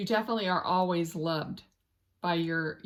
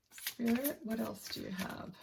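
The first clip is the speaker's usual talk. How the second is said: much softer, hushed question to spirit; quiet call to spirit; 4 semitones higher, 8 dB quieter than usual. Real, not acted. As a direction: murmur it as if asking an unseen guide. Asking spirit during reading.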